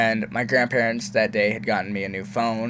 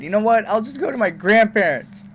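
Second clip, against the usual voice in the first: higher, louder huffy retort; defiant teen; 10 semitones higher, 4 dB louder than usual. Retold or imitated. Angry son threatens mom, huffy and high. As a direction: storm off in a huff.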